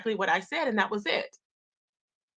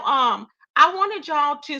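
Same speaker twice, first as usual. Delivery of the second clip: ordinary talk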